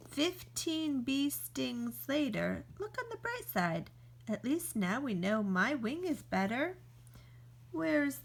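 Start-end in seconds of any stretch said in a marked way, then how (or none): 2.8-3.9 high, sing-song look on the bright side; chirpy consolation. Acted. After bee stings, offering chirpy high consolation. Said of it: "cheerful consolation, mock bright".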